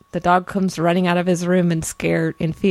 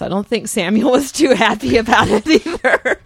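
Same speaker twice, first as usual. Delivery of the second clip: laughing; 5 semitones higher, 5 dB louder than usual. Real, not acted. Laughing comment on Samuel.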